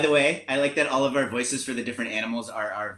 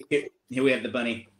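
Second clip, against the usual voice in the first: ordinary delivery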